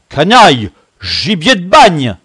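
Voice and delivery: high, loud bellowed insults; bellowing. A narrator's reading. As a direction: bellow abuse, drawing out the last. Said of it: "beugla Camus". (beugler des injures)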